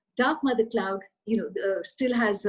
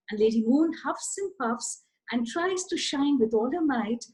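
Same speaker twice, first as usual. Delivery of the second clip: ordinary delivery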